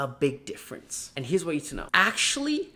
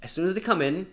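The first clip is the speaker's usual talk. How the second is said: ordinary talk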